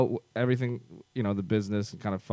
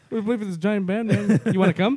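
Korg voice; 9 semitones higher, 3 dB louder than usual. Retold or imitated. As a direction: talk like the gentle rock alien. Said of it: "said in a Korg voice".

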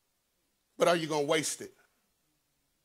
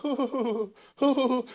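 high, hooting dove cries; mock-cry in high dove coos; imitated crying coo; 10 semitones higher, 5 dB louder, much slower than usual. Preacher showing how Hezekiah cried like a dove, high cooing. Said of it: "he was crying".

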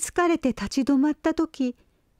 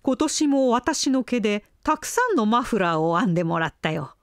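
lower, proud grown-up sheep; adult sheep voice; 5 semitones lower than usual. Acted.

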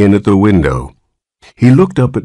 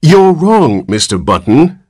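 severe; 9 semitones higher, 4 dB louder than usual. Acted. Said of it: "said the nurse severely".

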